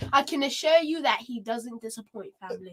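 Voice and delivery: mock English accent, emphatic assurance. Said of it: "get the English voice".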